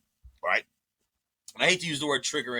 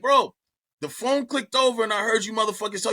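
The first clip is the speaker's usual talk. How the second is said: raised, heated voice, louder and higher; angry confrontation; 8 semitones higher, 4 dB louder than usual. Retold or imitated. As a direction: call him out, loud and fed up. Confronting friend on the phone.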